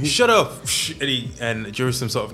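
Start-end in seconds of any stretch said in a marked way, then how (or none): 0.0-0.9 high, sharp shut up; disguised guard silencing a mouthy prisoner; snapping shut up. Acted. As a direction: snap at the prisoner.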